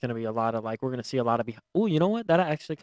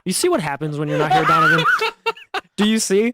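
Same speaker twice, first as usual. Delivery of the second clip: laughing; 9 semitones higher, 10 dB louder than usual. Real, not acted. Teasing absent friend, laughing.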